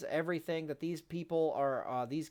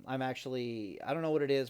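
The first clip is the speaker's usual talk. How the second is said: normal talking voice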